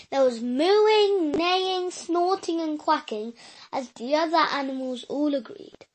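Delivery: usual speaking voice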